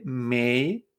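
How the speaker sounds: "mei" with a rising glide; drawn-out rising "mei"; second tone. Shown as a demonstration. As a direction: lift "mei" like a question. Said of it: "segundo tono".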